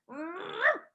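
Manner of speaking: high short growl; bear growl. Acted. Bear responds to Baba Brina.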